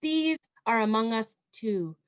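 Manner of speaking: measured poem recital, pausing between phrases; poetry reading. Acted. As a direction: lift and project each phrase, then let it settle. Reads second poem to audience.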